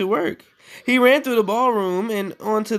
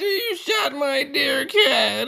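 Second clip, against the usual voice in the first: high, slow mock sobbing; fake-cry over the dead cat; hammed-up wail; 10 semitones higher, slower than usual. Stepsister wailing over her shot cat, hammed-up sobbing.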